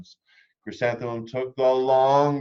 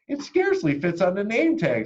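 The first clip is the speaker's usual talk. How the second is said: high, loud teasing; snooty schoolgirl; 10 semitones higher, 6 dB louder than usual. A narrator's reading. Rita teasing about the name tag, high and loud. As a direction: point and sneer. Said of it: "said Rita".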